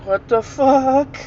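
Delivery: slow, high, drawn-out disbelief; bewildered boy. Retold or imitated. Boy pauses the TV on hearing a voice, slow high bewilderment. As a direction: imitate a baffled boy, stretching the last word. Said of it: "He's like".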